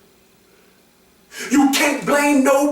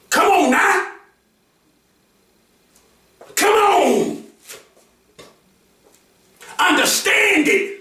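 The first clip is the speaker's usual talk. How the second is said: preaching; 5 semitones higher than usual. Real, not acted. Speaking to abused women, fired-up preaching.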